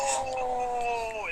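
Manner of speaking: long, high drawn-out "ohhh"; imitated crowd "oh". Wrestler imitating partygoers watching the chop land, mock crowd groan. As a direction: mimic onlookers reacting to a painful hit. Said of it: "everybody around him just goes".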